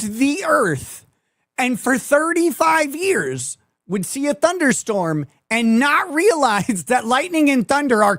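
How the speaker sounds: high, laughing disbelief, voice riding up; can't believe what he's telling you, half laughing; incredulous. Real, not acted. Incredulous rant about Candace Owens.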